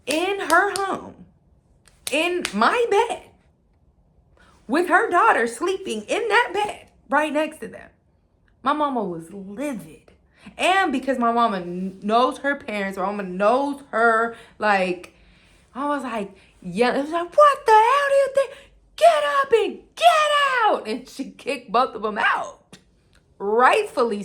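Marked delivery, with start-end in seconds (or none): 0.1-7.9 outraged. Real, not acted. Outraged retelling. 17.4-20.9 high, loud furious yell; angry shouting mother. Retold or imitated.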